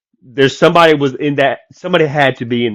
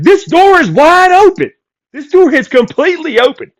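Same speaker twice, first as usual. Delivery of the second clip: alarmed; 8 dB louder than usual. Retold or imitated. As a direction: stretch it out high and loud, shocked. Homeowner finds open door.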